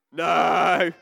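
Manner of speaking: loud firm no; emphatic no. Real, not acted. Vicar answering her own question to the children, loud. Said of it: "rhetorical answer to children".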